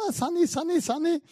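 high, calling out across the house; sing-song call for a dog who won't come. Retold or imitated. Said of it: "also hab gerufen". (hoher Lockruf nach dem Hund)

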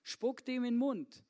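voicing God. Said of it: "Wenn der Gott zu mir sagt".

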